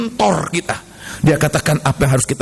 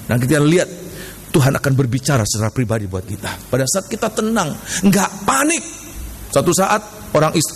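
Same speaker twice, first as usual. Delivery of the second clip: ordinary delivery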